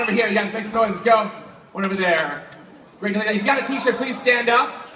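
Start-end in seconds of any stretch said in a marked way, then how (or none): none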